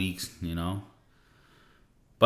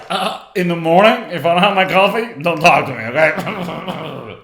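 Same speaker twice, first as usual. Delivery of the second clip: mock grumpy coffee person, high and loud, ending in a laugh; high, loud send-up of a caffeine addict; coffee addict impression; 4 semitones higher, 7 dB louder than usual. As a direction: play the morning person who needs coffee first. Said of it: "I'm not like one of those people like".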